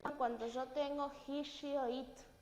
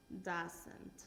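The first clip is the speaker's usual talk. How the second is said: Doesn't repeated much softer, almost to self; 6 semitones lower, 8 dB quieter than usual. Shown as a demonstration. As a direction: echo the word Doesn't quietly after the model.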